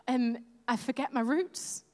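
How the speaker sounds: ordinary talk, nothing marked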